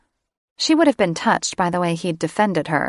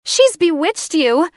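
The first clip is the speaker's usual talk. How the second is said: much higher, louder shriek; 11 semitones higher, 6 dB louder than usual. Acted. Catherine accusing Sarah over Drake's shoulder, shrieking. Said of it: "she shrieked".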